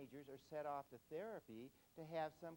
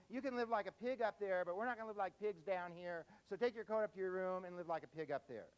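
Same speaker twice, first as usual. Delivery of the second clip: stern father raising his voice; scolding dad; 5 semitones higher, 7 dB louder than usual. Retold or imitated. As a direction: scold the kid like a fed-up father. Dad scolding kid over coat.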